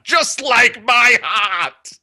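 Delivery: slow, high, loud heartbroken wail; melodramatic wail. Acted. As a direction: wail it like a tragic poet.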